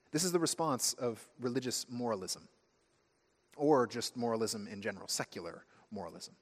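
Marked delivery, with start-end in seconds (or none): none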